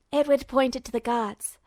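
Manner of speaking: usual speaking voice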